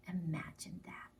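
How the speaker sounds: hushed wondering whisper. Acted. Whispered aside.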